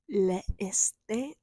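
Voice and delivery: put-on a slow, clumsy try at a French accent on "Le estate"; bad French accent. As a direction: attempt the French accent and fail. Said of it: "I can't do a French accent".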